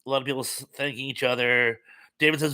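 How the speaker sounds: normal talking voice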